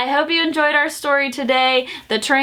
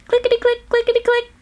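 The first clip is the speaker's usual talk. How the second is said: high, tiny clickety-click; squeaky toy-train chant; little train voice; 11 semitones higher, faster than usual. A narrator's reading. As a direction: make it sound like a tiny train.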